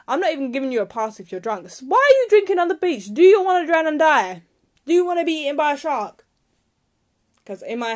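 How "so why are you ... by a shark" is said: higher and louder, real exasperation; venting fury at litterers; angry rant. Real, not acted. Ranting about rubbish on the beach, really angry. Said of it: "I know why I'm getting so angry".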